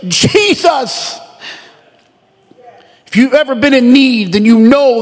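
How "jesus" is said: higher, drawn-out pleading call of Jesus; repeated plea, each Jesus falling; pleading cry. Acted. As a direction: cry the name out like a drumbeat plea. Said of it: "a repetitive sound like a drum beating out a plea".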